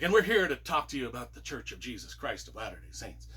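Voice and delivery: lightly voiced low cowboy pitch; a hint of the old-West narrator at a doorway; Western narrator voice. Missionaries at the door, a lightly low Western voice. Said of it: "we started talking that way ourselves".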